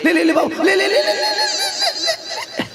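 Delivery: high mock baby-feeding voice; coaxing mother. Mother coaxing her baby with food, mock cooing.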